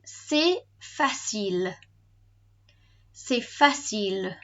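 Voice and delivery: slow, measured "C'est facile"; model pronunciation. Shown as a demonstration. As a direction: say C'est facile slowly for learners to repeat. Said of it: "let's try it's easy".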